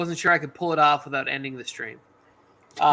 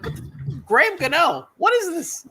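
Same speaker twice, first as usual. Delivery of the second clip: mock disbelief; 7 semitones higher, 5 dB louder, faster than usual. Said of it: "And I was like".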